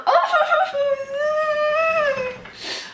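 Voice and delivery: very high, long held wail; anguished laughing wail. Real, not acted. Fan whose interception won't count, anguished high wail. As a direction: let out a laugh that turns into crying.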